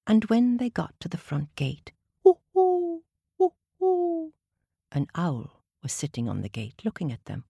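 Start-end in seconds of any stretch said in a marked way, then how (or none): 2.2-4.3 high hooting owl. Acted. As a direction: hoo like an owl.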